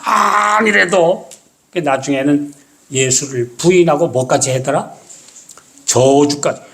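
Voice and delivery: ordinary talk, nothing marked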